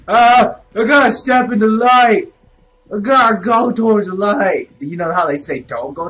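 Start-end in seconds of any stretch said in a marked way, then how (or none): 0.1-4.7 high, loud, playfully dramatic; urgent cry. Pretend. Gamer rushing towards the light, dramatic urgency. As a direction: call out with hammed-up urgency.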